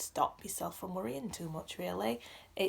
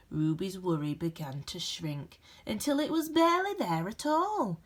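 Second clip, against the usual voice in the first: gentle, expressive storybook reading with dramatic pauses; reading to children; 3 dB louder than usual. A narrator's reading. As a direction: read it like a bedtime picture book for a class. Reads picture book aloud.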